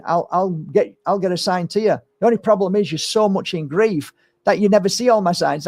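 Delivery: ordinary delivery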